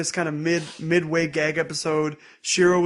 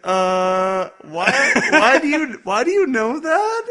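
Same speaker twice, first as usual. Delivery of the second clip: much higher, louder, slowing and laughing; mock shocked disbelief; 7 semitones higher, 7 dB louder, slower than usual. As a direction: gasp it out in scandalized disbelief. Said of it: "Sayaka's like".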